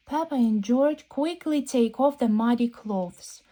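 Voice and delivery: ordinary delivery, nothing marked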